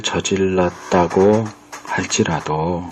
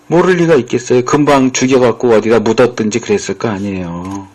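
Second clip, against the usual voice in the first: ordinary delivery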